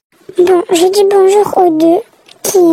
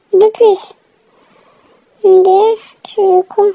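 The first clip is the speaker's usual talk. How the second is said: ordinary delivery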